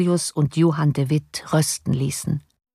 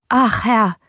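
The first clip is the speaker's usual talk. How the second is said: raised, higher call to his master; servant calling out; 5 semitones higher than usual. A narrator's reading. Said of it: "rief der Diener".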